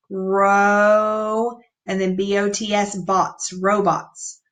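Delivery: "ro-" then "bots" split, then "robots" whole; teacher chunking "robots" slowly; syllable breakdown. Shown as a demonstration. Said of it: "break it down into syllables".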